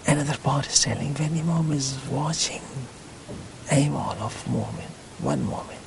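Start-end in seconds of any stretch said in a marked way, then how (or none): none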